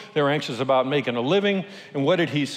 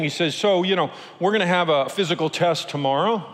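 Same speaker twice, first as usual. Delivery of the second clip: mock guide reassurance; 3 semitones higher than usual. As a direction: sell safety with a grin. Guide reassures nervous newcomer.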